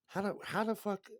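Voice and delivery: high, disbelieving cry of how the fuck; baffled outburst. Retold or imitated. Jealous guy baffled by a rival's luck, high incredulous cry. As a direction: throw it up high in mock disbelief. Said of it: "You're like".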